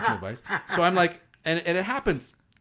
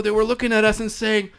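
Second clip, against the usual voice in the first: ordinary talk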